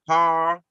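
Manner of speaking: horror said short as "har", no vowel in the middle; clipped har. Shown as a demonstration. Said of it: "different ways to pronounce it".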